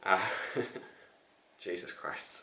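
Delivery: winded, voiceless exhale; barely voiced, very soft, spent from the stairs; out of breath. Real, not acted. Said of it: "I'm out of breath".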